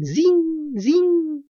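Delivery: high tinkling zin, zin; coins jingling. Acted. Monedas sonando al viento. (tintineo agudo de monedas)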